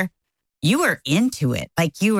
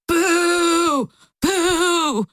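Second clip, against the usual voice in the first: mock booing; 13 semitones higher than usual.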